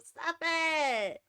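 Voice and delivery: normal talking voice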